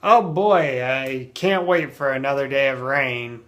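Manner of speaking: mock enthusiasm sinking away; loud "oh boy", then drily unimpressed; sarcastic. Shown as a demonstration. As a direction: fake delight at dreary news. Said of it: "somebody say sarcastically".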